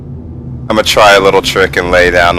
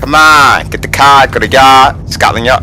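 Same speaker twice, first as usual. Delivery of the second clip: higher-pitched hammed-up Boston accent; mock Boston accent; 5 semitones higher than usual. Joking with friends about accents, mock Boston accent. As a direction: put on a Boston accent, r's dropped. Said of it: "Is that the Boston accent?".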